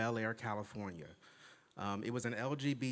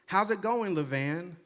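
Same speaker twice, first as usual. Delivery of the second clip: high, louder mock-cheery hello; friendly greeting; 6 semitones higher, 4 dB louder than usual. Three writers greeting a mistaken acquaintance, mock-friendly. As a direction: imitate writers brightly hailing someone. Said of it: "they're waving and smiling".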